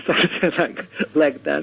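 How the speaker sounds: normal talking voice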